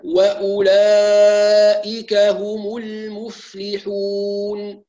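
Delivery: وأولئك drawn very slowly, hamza firm; slow recitation, clear hamza. Shown as a demonstration. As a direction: recite the verse slowly and exactly. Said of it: "هكذا". (وأولئك هم المفلحون بترتيل وتحقيق الهمزة)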